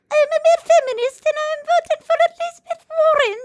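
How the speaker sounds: very high-pitched attempt at an Irish accent; bad Irish accent. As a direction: squeak out a bad mock-Irish voice. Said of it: "I can't do an Irish accent".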